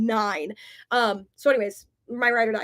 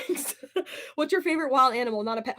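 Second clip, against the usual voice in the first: ordinary talk, nothing marked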